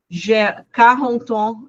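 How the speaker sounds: "J'ai quarante ans" slow and carefully spaced; slow demo. Shown as a demonstration. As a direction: say "J'ai quarante ans" slowly, like a teacher at the board. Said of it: "Eu posso usar pra dizer a idade".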